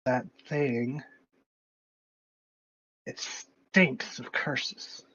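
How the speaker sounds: reedy whispery voice, slow; a thin eerie voice from nowhere. Acted. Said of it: "thin, reedy voice that goes".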